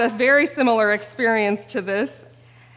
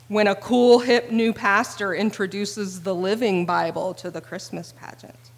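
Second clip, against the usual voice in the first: ordinary talk, nothing marked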